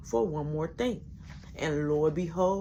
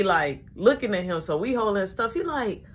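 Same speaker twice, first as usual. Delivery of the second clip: ordinary talk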